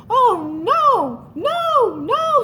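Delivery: high, loud rising no no no; frightened squeaks. Acted. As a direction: squeak in terror. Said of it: "squeaked little Mouse".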